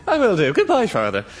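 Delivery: put-on Irish accent. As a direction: sign off in a stage Irish accent. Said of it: "Copy that accent".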